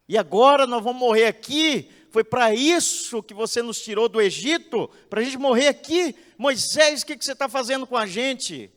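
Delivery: loud, high, desperate cries of a frightened people; a crowd voicing its fear of dying; panicked crowd. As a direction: cry out to Moses as if death is coming. Frightened people crying out to Moses.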